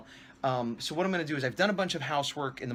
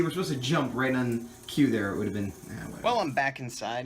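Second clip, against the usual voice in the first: ordinary delivery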